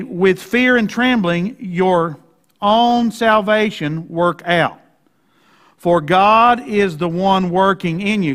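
very slow, deliberate literal rendering; slow Greek reading. Shown as a demonstration. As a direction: lay out each word in Greek order. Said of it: "finish reading this in the Greek".

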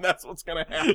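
ordinary talk, nothing marked

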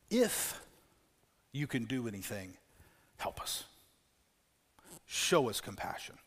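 soft, halting, weary plea; worn-out father's quiet plea, word by word; drained and frustrated, with long pauses; exhausted, frustrated request. Acted. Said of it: "mixed with irritation, exhaustion, frustration".

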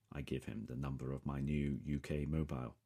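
usual speaking voice

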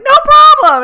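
very high, loud sing-song chirp; squeaky cheerful. Retold or imitated. To the stranger with the cake: squeaky high cheerful chirp. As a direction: chirp it way up like a cartoon.

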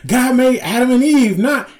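high mock-preachy rant; ranting old man. Old man lecturing crowd in sketch, mock rant. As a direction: imitate a worked-up old man, breaking into laughter.

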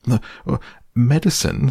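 high breathy stammer; alarmed. A narrator's reading. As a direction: gasp it out in dread. Piglet dreading the medicine.

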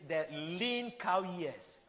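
ordinary delivery

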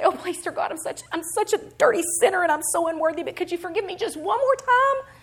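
high, hurried, exaggerated pleading; mock grovelling. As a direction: beg like a hammed-up wretched sinner. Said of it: "I'm going to grovel before the Father".

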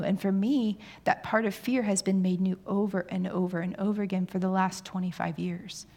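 ordinary talk

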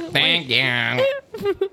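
odd voice. Pretend. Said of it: "I don't like that, Beth".

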